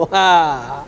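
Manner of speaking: usual speaking voice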